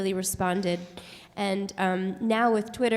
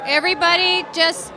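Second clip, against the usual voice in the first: shouting over fire alarm; 7 semitones higher, 9 dB louder than usual. Real, not acted. Fire alarm, calming crowd.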